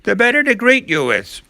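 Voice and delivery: very high, loud false voice; fake grandma. Wolf in disguise answers the girl, fake grandma. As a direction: keep up the old-lady falsetto.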